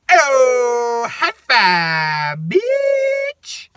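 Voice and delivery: loud, high, drawn-out comic cheer; hammed-up triumphant squeal; gloating. Worker gloating over denied refund, squealing.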